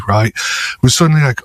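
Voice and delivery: normal talking voice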